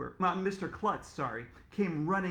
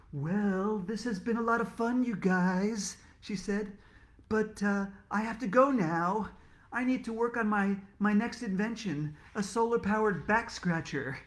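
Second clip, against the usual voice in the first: hasty excuses; 5 semitones higher, 4 dB louder than usual. Acted. Inventor makes excuses to leave.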